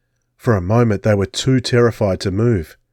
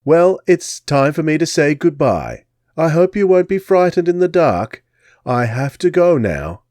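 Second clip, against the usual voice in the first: higher, louder English lady, mock kindly; Englishwoman farewell; 6 semitones higher, 3 dB louder than usual. Englishwoman bidding the boys goodbye. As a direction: say goodbye sweetly, English accent. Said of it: "the woman replied".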